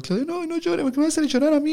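very high imitated Argentine pleading; high-pitched Argentine, begging him not to cry; Argentine accent. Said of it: "el argentino".

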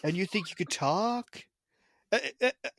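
slow middle-American talk with long pauses; imitated slow American accent. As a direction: mimic a slow American, stopping and restarting. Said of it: "They talk a lot slower".

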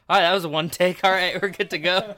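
calling out cut. Retold or imitated. Director calling cut on set.

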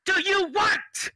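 shouted high and slow; yelling. Pretend. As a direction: scream the question at them. Mock ad erupts into yelling.

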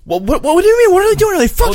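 very high, loud mimicked rant; frantic angry yelling. Jenna grilling Chris about her man, frantic angry yelling. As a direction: fire off questions in a shrieked mock fury. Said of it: "Jenna gets angry and starts yelling".

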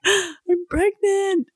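very high, slow "I'm pregnant"; squealed announcement. Retold or imitated. Telling Shane the news, slow high squeal. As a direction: stretch it out, bursting with joy.

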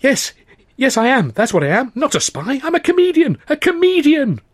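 high, loud eager agreement; eager relief. Acted. Narrator seizing on being recognised, eager and loud. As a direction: grab the lifeline.

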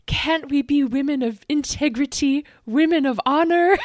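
mock pleading Vicky. Cohost mimics a crying Vicky begging the women at the bar, high and pleading. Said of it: "she was like".

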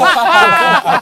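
a sudden high, loud burst of laughter. Real, not acted. Laughing at his old coach's sarcastic robot jibe, loud. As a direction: crack up at the memory.

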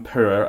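"poor" as POO-r, R sounded; Scottish pronunciation. Shown as a demonstration. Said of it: "Three different pronunciations".

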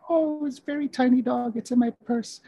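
put-on high-pitched sweet old lady; put-on falsetto of a kindly old dame; Missus Doubtfire. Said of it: "sweet old lady".

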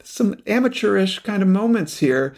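imagined critical listener. Pretend. Radio professional judging an amateur's audiobook, lightly mimicked reservation. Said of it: "as a radio professional, you're probably thinking".

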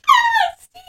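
high pitched mock squeal; squealing girl voice. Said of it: "she's like".

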